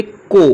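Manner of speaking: C sounded as a hard "को"; teacher giving "को" as a clear standalone sound; sound demonstration. Shown as a demonstration. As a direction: isolate the consonant sound "को", firmly. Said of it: "सी का उच्चारण क्या हो रहा है".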